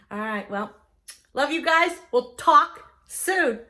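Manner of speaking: drawn-out, slow, loud "We'll talk soon"; slow sign-off. Shown as a demonstration. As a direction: stretch the goodbye playfully. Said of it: "See what I did there?".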